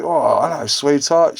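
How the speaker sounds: mock a cocky nineties lad flirting; nineties bloke chat-up. Mimics nineties bloke chatting up.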